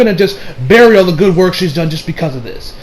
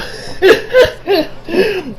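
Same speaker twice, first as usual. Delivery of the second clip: big high laugh; 12 semitones higher than usual. Real, not acted. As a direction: burst of high-pitched laughter. Reacts to "Vic's a player.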